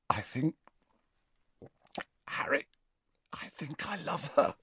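soft, halting, choked confession; strangled. A narrator's reading. Ron confesses love to Harry, strangled. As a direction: squeeze it out quietly in broken pieces. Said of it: "said Ron in a strangled voice".

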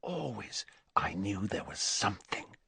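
hushed, unvoiced self-talk; whispered. A narrator's reading. Boy realising he was always special, whispered. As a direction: keep whispering, as if only to yourself. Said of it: "he whispered to his own quivering fingers".

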